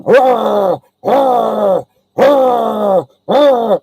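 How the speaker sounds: repeated loud, high dog growls; long rolling dog snarls; imitated dog growling. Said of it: "the dog is going".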